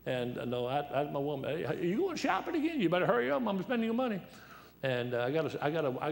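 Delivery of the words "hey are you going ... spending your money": wife's voice. Retold or imitated. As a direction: squeal it like a gleeful shopper. Wife voiced teasing.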